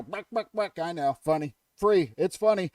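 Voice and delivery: ordinary delivery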